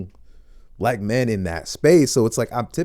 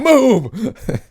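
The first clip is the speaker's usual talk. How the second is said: haughty mock command; 13 semitones higher, 10 dB louder than usual. Mock celebrity dismissing him.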